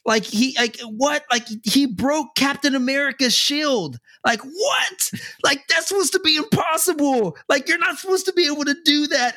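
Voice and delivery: put-on awed kid. A kid marvelling at the villain on screen, high and awed. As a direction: play a child amazed by raw strength, voice way up. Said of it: "They're like".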